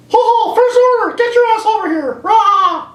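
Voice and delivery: high, loud cartoon mouse shout; mock Mickey yelling. Mickey calling the First Order for help, high squeaky yell. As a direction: bellow for backup in a squeaky voice.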